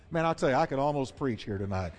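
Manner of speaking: usual speaking voice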